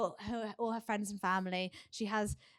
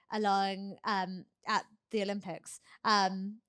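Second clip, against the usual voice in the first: ordinary talk, nothing marked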